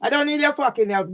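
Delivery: usual speaking voice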